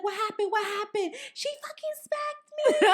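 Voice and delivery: high-pitched frantic imitation, climbing into a squeal; hammed-up shrill alarm; mock frantic. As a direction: act out a panicked squabble in a high voice. Retelling a frantic fight at the door.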